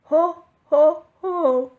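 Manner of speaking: big, high, loud belly shout. A narrator's reading. Santa arriving home, loud hearty shout. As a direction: boom out the ho ho ho. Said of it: "shouted Santa loudly".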